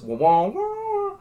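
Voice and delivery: rising wavering wah wah; theremin imitation. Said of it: "you know what it sounds like".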